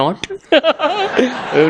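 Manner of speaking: high giggle after a punchline; amused laugh. Real, not acted. Speaker laughing at own punchline, high giggle.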